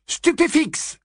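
high-pitched combat incantation; shouted spell. Acted. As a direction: fire the spell off, high and hard. Spell cast in a fight. (incantation criée, très aiguë)

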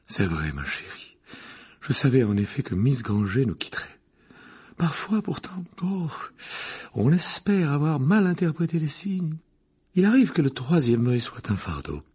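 theatrical, sighing clairvoyant; moved seer. A narrator's reading. Professor lamenting the burden of foresight, moved. Professor accepts credit for prophecy.

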